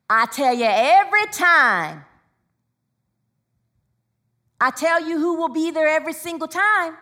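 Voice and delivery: rousing preacher's call; building preaching. Real, not acted. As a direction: drive the congregation toward the answer. Preacher building up to congregation response.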